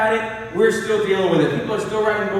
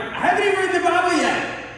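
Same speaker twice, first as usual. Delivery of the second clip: incredulous Moses; 6 semitones higher than usual. Retold or imitated. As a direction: protest it like a joke. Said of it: "I'm like".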